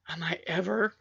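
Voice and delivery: fearful whisper. Retold or imitated. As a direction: breathe it like a trapped child. Child's fearful thought.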